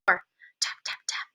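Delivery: softly whispered tapping sounds. A narrator's reading. Mouse tapping on grandmother's door, whispered. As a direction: whisper the taps under the breath.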